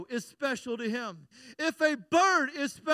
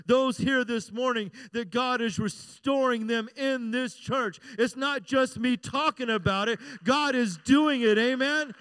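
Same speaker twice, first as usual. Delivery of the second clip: ordinary talk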